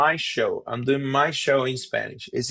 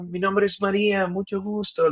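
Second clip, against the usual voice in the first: higher, eager mi nombre es Maria in Spanish; Spanish introduction; 7 semitones higher than usual. Retold or imitated. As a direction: proudly introduce yourself in Spanish. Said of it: "they go like".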